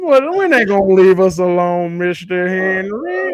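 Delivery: put-on slow, drawn-out old-time servile voice; tap dance man impression. Streamer acting the Them character asking Mister Henry, drawn out slowly. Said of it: "That was a good accent there".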